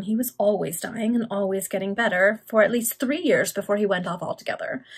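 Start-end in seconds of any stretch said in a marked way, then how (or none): none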